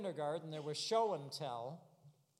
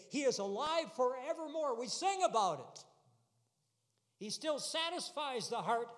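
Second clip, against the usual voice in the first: high, loud pulpit proclamation; rousing sermon climax, building in repeated bursts; full-force preaching; 5 semitones higher, 5 dB louder than usual. Real, not acted. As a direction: drive each declaration home to the congregation.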